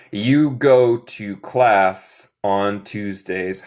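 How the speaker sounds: very slow, evenly spaced words; slow model reading. Shown as a demonstration. Teacher models a habit sentence for students, slow model reading. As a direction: read it out slowly for learners.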